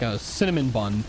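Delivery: ordinary talk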